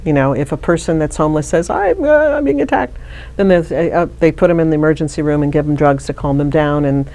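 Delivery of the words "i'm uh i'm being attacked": high-pitched mock distress; mock panic. Imitating a homeless person who says they're attacked, mock panic. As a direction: voice an alarmed person much higher, lightly hammed-up.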